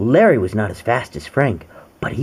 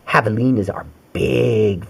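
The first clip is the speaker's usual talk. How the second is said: ordinary delivery, nothing marked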